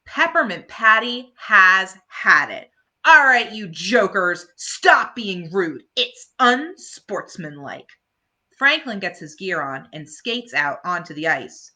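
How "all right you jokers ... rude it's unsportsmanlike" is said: louder, slow firm reprimand; stern scolding. Acted. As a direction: lay down the law. Patty scolding rude kids.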